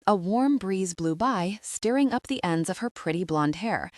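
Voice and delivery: ordinary delivery, nothing marked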